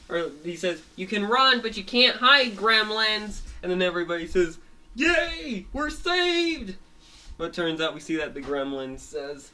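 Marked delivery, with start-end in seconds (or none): 1.0-3.4 high, loud villain taunt; gloating villain. Acted. Villain taunts the gremlins, gloating shout. 4.9-6.7 high-pitched celebrating squeal; joyful cheer. Acted. Everybody cheers at being saved, high joyful cry.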